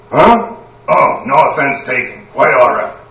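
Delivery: jolted Huh, then smooth and polite; startled polite stranger. Acted. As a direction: brush it off courteously.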